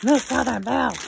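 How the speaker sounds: loud, playful catchphrase imitation; loudly mimicked name; imitating Miss Southern Belle. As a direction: call out the name as its owner would. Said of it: "As you would say".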